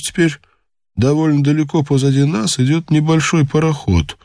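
lightly voiced lookout on watch; alert lookout reporting. Lookout reports to his chief.

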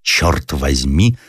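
«Чёрт возьми» drawn out slowly, German-style, rising on «возьми»; German-accented curse. Acted. Unseen German soldier nearby, slow German-accented «Чёрт возьми». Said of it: "ясно выговоренный немецкий".

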